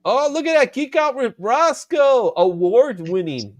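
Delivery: high, drawn-out announcer-like hail; excited hailing. Pretend. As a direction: hail a champion, high and stretched out, settling at the end. Hails award-winning viewer in the chat.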